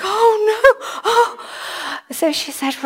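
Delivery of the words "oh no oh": high crying wail of dismay; anguished cry. Retold or imitated. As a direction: let the dismay break into a cry. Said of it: "I was like".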